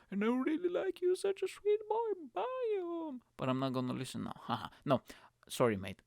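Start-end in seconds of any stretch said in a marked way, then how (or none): 0.1-3.2 high, sugary fan pleading; high doting listener voice; mock fan voice. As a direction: play a doting fan saying goodbye.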